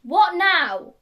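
loud, high, irritated answer; exasperated snap. Acted. Villain answering mum's next call, exasperated shout. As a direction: explode at yet another interruption. Phone interrupts villain once more.

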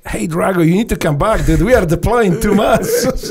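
teammate's urgent recall. Retold or imitated. Teammates calling him back.

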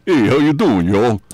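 loud New York "y'all" greeting; New York accent. Retold or imitated. New Yorker greeting folks after weeks down South, New York accent. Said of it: "with a New York accent".

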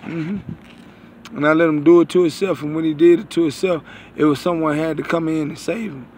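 ordinary talk

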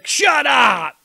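imitation heel yell, high and loud; yelled taunt. Host imitating bad guys mid-match, yelling. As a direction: yell it like a bad guy working a guy in the ring. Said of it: "they're yelling at the".

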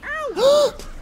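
high, sudden gasp; sharp gasp. Real, not acted. Player sees the climb going wrong, shocked gasp. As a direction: suck in air sharply in shock.

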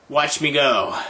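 ordinary talk

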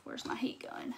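under-her-breath whisper to herself; whispered aside. Real, not acted. Crafter on a live stream, whispering to herself about her heat gun. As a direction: whisper it to yourself, almost unheard.

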